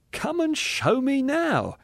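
very high, slow, merry lilt; laughter in voice. A narrator's reading. Spirit daring the ghost to show him, merry. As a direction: sing it out gleefully. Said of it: "with laughter in his voice".

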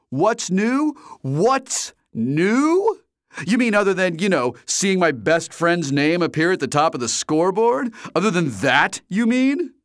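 high, loud sarcastic echo; mocking friend H. A narrator's reading. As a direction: throw his question back mockingly. H mocking reply.

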